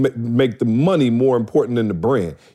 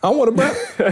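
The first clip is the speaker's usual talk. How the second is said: much higher mock voice of the brother; 11 semitones higher than usual. Imitating his brother teasing about the shirt, much higher and laughing. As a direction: poke fun at his brother's whining, pitched way up. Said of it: "who was like".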